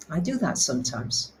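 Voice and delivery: ordinary talk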